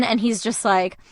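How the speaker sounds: usual speaking voice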